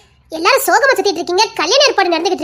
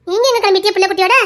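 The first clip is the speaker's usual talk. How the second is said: normal talking voice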